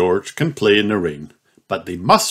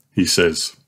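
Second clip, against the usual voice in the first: plain reading voice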